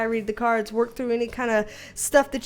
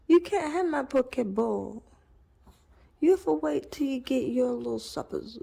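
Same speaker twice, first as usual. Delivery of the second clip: pet talk; 5 dB quieter than usual. Real, not acted. Teasing the puppy begging for food, soft baby talk. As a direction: coo at the dog.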